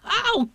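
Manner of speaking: high squealed ow; little animal's pained squeak; cried "ow". Acted. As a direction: yelp in a tiny voice. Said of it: "cried Piglet".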